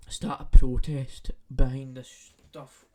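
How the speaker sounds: loud declaration; rally them loudly; loud rallying call. Real, not acted. Call to action.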